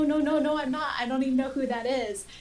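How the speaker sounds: ordinary talk, nothing marked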